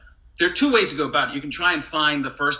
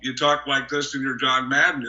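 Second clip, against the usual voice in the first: ordinary delivery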